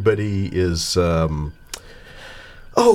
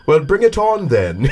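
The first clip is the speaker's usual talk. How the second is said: put-on British accent; 5 semitones higher than usual. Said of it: "with that British accent".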